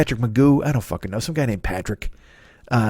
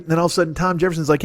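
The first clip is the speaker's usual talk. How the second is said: usual speaking voice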